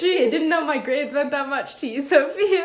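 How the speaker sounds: 1 laughing tease. Real, not acted. Playful teasing of co-host.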